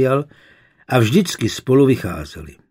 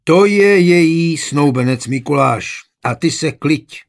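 loud, higher-pitched dismissal; angry shout; 4 semitones higher, 7 dB louder than usual. A narrator's reading. Father shouts at the unwanted suitor, angry. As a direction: shout him out of the house. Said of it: "vykřikl Wolf".

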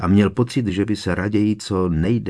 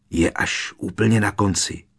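plain reading voice

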